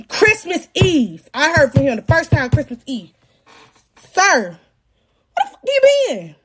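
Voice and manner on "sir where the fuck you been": mock angry. Confronting baby daddy.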